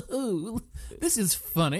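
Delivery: high, soft self-amused aristocrat; mock a posh writer tickled by his own joke; regal Julian. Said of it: "regal Julian".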